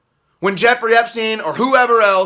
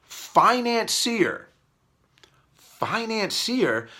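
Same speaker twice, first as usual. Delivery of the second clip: slow, drawn-out sneering Financier; mocking the word; 7 dB quieter, much slower than usual. Mocking the media's word to viewers, slow and sneering. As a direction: drag out Financier as if it's absurd. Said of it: "financier, they say".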